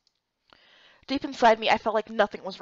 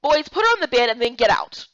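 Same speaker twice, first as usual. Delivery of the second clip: louder, commanding; firm order; 8 dB louder than usual. Acted. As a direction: take charge of the room. Nurse orders the boys out.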